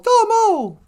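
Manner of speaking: domo squeaked very high and loud; squeaky domo. Shown as a demonstration. Echoing the song line, domo squeaked high. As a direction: pop the second domo up high.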